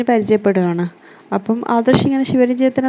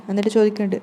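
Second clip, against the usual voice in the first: ordinary delivery, nothing marked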